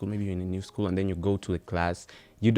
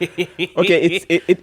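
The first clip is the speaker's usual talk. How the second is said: laughing; 9 semitones higher, 10 dB louder, slower than usual. Real, not acted. Laughing after accent joke.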